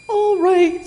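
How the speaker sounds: high, loud little piglet voice; squeaky, resigned piglet; sighing piglet. A narrator's reading. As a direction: give in with a high-pitched piglet sigh. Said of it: "sighed the piglet".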